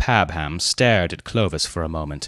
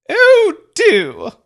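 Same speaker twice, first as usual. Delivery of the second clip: high, louder earnest plea; earnest young lady; 8 dB louder than usual. A narrator's reading. Mavis begging Leonard to perform, high earnest. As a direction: urge him on eagerly in a high voice. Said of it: "said Mavis Pellington earnestly".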